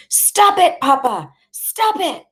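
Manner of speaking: very high-pitched pleading shout; desperate yell. A narrator's reading. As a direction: yell at your father to stop.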